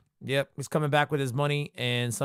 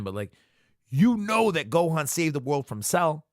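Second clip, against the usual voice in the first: normal talking voice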